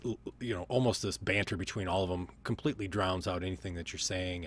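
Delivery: ordinary talk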